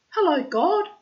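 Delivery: high, loud call; high bright hail. A narrator's reading. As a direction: call out to God from the doorway. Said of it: "called Sparrow".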